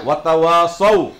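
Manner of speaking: "ওয়াতাওয়াসাউ" slow and loud with a heavy sad; slow correct recitation. Shown as a demonstration. Teacher drilling the class, slow loud "ওয়াতাওয়াসাউ" with sad. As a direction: draw out "ওয়াতাওয়াসাউ", the স full and thick. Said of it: "বলো একবার বলো".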